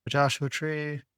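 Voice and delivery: ordinary talk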